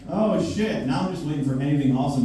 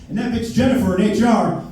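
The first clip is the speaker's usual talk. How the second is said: higher, louder sudden "Jennifer in H R"; mock gossip punchline; 9 semitones higher, 4 dB louder than usual. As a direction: snap it out like catty office gossip. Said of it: "what I overheard".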